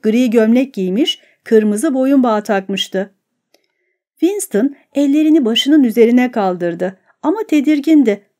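usual speaking voice

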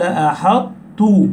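"أحطت" with an emphatic covered ط released into a doubled ت; إطباق ثم تاء مشددة. Shown as a demonstration. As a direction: hold a heavy ط in "أحطت", then drop onto a light doubled ت. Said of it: "تأتي بصفة الإطباق في الطاء". ("أحطت" بإطباق الطاء ثم تاء مشددة)

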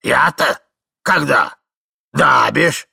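put-on confused old codger; bewildered old man. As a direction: dawdle loudly, baffled, then remember.

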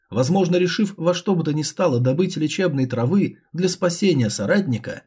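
usual speaking voice

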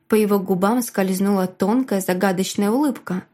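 plain reading voice

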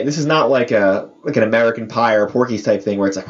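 usual speaking voice

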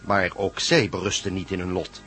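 usual speaking voice

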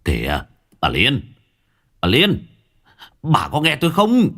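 calling his wife back. Acted. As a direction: call after her as she runs. Calling after fleeing wife.

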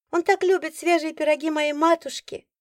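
high, put-on sweet young woman; sweet girl voice. A narrator's reading. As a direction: flaunt her mother's pies sweetly.

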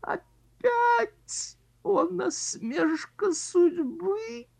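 very high, slow anguished moan. Acted. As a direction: moan in despair at living. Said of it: "он простонал".

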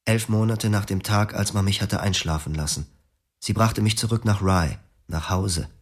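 ordinary talk

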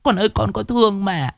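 high, keening mother calling to her dead son; mother lamenting at the incense for her son; grieving lament. Acted. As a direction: pour out a mother's sorrow in a sing-song wail. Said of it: "lẩm bẩm".

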